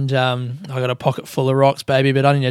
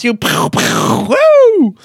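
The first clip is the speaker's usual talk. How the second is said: gunshot noises; 8 semitones higher, 4 dB louder, much slower than usual. Pretend. Miming gunshots for an announcement.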